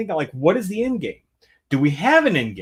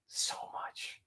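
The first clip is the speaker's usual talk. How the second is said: breathy trailing whisper; 22 dB quieter than usual. Real, not acted. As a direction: let it die away to nothing. Trailing off in disgust.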